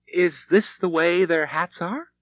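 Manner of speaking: a light, hopeful question about the hat. A narrator's reading. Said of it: "she asked Shane".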